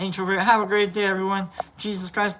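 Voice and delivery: normal talking voice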